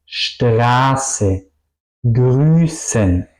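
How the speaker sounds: long vowel, long S in "Straße, Grüßen"; vocal y ese largas. Shown as a demonstration. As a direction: hold both vowel and S slowly in "Straße, Grüßen". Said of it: "vocal y la S suenan largas". ("Straße, Grüßen" con vocal y ese largas)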